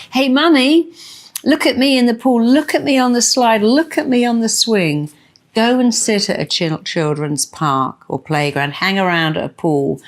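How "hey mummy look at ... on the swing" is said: put-on high, loud little kid calling out to mum; excited child. A child shouting to Mummy from the pool, high and excited. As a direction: squeal it like a kid showing off. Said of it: "when they go".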